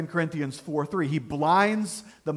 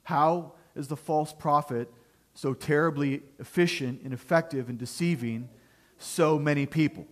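ordinary delivery

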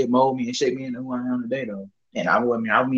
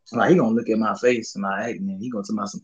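ordinary talk

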